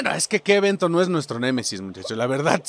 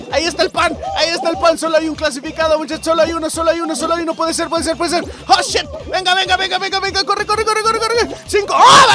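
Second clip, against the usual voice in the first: frantic excited commentary; 9 semitones higher, 3 dB louder, faster than usual. Real, not acted. Racing for last spot.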